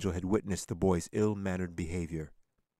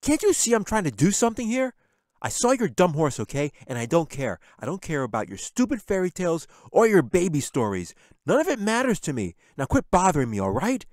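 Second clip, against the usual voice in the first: put-on loud, high angry boy; shouting in a rage; furious yelling; 10 semitones higher, 10 dB louder than usual. A narrator's reading. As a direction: explode at the pestering sister. Jack rounds on Katie.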